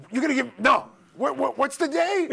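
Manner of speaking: high, loud stammered outburst; alarmed, tripping over the first words; flustered actor. Retold or imitated. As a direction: imitate someone hastily changing his mind. Retold actor suddenly changes his mind.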